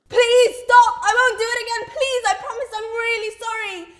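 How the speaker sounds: high, loud begging, speeding up; desperate pleading. Acted. Beaten man apologising to Fazana, pleading loudly. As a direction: beg frantically for it to end.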